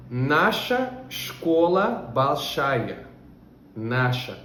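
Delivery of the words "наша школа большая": very slowly, word by word, for learners; Russian sentence spelled out slowly; very slow model reading. Shown as a demonstration. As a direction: read it out like a teacher dictating. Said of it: "A nossa escola é grande".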